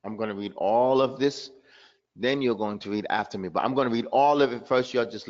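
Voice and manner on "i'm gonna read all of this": ordinary talk, nothing marked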